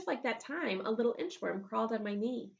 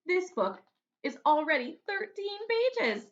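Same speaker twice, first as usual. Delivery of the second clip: put-on high, loud, slow amazed girl; excited little girl voice; Daisy amazed; 8 semitones higher, 6 dB louder than usual. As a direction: marvel at it, a proud little girl. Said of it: "she said".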